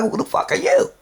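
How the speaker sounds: sharp, high, outraged comeback; indignant retort. Retold or imitated. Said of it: "I'm like".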